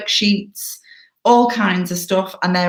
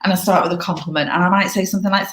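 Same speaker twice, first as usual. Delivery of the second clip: ordinary delivery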